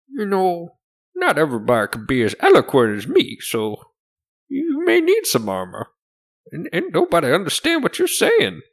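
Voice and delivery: high-pitched, loud, condescending merchant voice; haughty shopkeeper. Acted. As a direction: swoop up high like a snooty shopkeeper. Game master voices smug shopkeeper.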